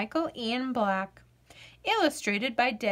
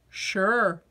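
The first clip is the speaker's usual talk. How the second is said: ordinary delivery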